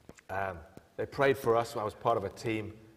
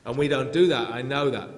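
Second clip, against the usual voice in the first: louder, insisting to the congregation; emphatic preaching; 4 semitones higher, 11 dB louder than usual. Real, not acted. Preacher firmly reassuring his congregation, loud and emphatic. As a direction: drive the point home with force. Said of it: "preaching at full force".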